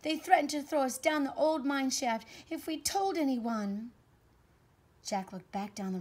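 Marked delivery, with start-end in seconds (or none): 0.0-3.9 put-on high, loud tattling child; loud, worked-up child's voice; urgent alarm. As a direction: report a threat in alarm. Claire reports threat in alarm.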